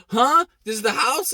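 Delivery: high startled question; confused surprise. Retold or imitated. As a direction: sound caught off guard.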